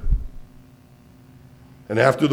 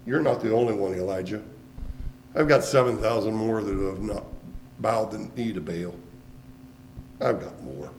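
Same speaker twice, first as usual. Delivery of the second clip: much lower and softer, reassuring; God quietly reassuring Elijah, low and soft; hushed voice of God; 4 semitones lower, 5 dB quieter than usual. Acted. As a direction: speak it close and gentle.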